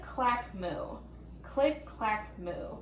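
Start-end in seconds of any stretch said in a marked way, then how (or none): none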